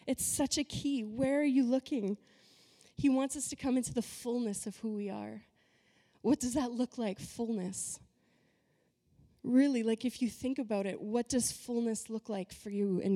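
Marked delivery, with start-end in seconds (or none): none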